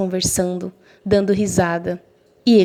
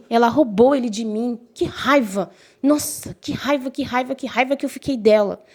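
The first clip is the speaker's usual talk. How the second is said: higher, exasperated repeated outburst; fuming; 4 semitones higher than usual. Retold or imitated. Jealous girl fuming about her friend, in a high exasperated voice. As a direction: vent the rage in a pitched-up whine.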